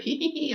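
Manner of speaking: high squeaky imitated giggle; mock girly giggle. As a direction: titter in falsetto, mimicking her. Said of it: "she's like".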